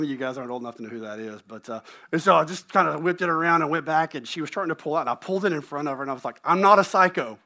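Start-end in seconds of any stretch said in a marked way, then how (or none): 6.4-7.4 loud, raised-pitch protest; retold urgent protest, much louder; insistent disclaimer. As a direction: call it out urgently to a wary stranger. Acted urgent reassurance to stranger.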